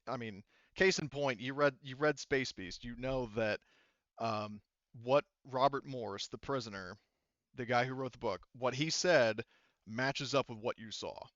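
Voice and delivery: normal talking voice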